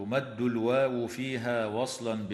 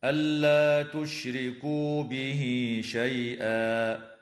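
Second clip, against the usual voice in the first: recite and stop; 2 semitones higher, slower than usual. Shown as a demonstration. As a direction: stop on شيئا with the tanween turned into a long a, shay'aa. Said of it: "هكذا".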